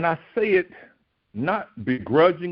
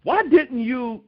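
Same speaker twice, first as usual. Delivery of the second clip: exasperated rebuke; 6 semitones higher than usual. Retold or imitated. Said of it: "just looked at him and said".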